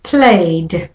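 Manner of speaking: Played, A vowel gliding into D; played with a final d. Shown as a demonstration. Teacher models played for learners. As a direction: let the A vowel run into D in Played. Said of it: "goes from A, the A vowel, to the D sound".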